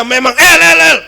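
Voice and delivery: loud, high retold calling; hard tone. Friend Jupri calling him at night, hard loud tone. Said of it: "Memang dia nadanya keras".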